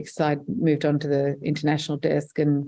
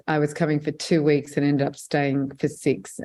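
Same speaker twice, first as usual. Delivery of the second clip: normal talking voice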